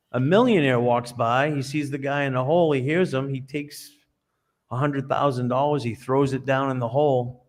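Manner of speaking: ordinary talk, nothing marked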